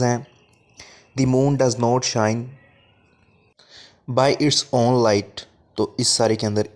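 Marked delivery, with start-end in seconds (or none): 1.2-5.4 slow dictation. Shown as a demonstration. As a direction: read it out as if the class is writing it down. Teacher reads the example aloud slowly. (बहुत धीरे, एक-एक शब्द बोलकर, थोड़ा ज़ोर से)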